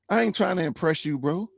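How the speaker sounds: ordinary talk, nothing marked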